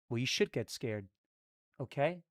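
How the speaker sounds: low, soft stern father voice; daddy voice. Pretend. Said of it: "when you do your daddy voice".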